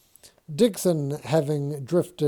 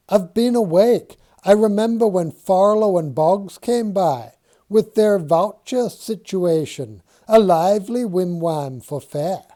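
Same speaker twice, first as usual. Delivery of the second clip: louder, higher indignant insistence; insistent Dixon; 6 semitones higher, 7 dB louder than usual. Acted. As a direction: protest too much that you were awake.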